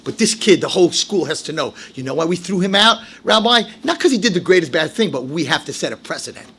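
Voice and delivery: ordinary talk, nothing marked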